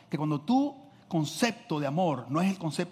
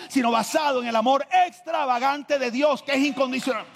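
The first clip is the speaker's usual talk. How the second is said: from steady to loud fervent preaching; building preaching; 7 semitones higher, 4 dB louder than usual. Acted. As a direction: climb back to full pulpit force. Preaching rises again. (predicación que va subiendo hasta el clímax)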